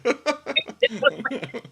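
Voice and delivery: high, loud burst of laughing; loud laughter. Real, not acted. Teasing a guest about the failed plan, loud high laughter. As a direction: crack up loudly at your own joke.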